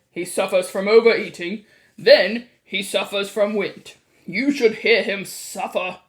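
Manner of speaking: loud, booming complaint; gruffly dismissive, at full volume; blustering father. A narrator's reading. Mr. Jenkins complains about his son, loudly blustering.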